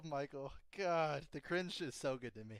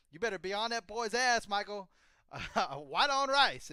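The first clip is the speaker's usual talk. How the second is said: Southern Joe Bennett; 6 semitones higher, 7 dB louder than usual. Retold or imitated. Retelling an Office scene, a loud mock Southern scolding. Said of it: "she's like".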